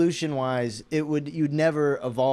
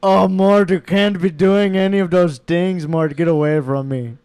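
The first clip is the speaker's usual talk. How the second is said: loud, raised lemur-king voice in an Indian-style accent; shouted, hammed-up cartoon royal scolding; King Julian; 3 semitones higher, 8 dB louder than usual. Shown as a demonstration. Lemur king telling Mort to get away, loud accented scolding. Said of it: "King Julian is an Indian accent".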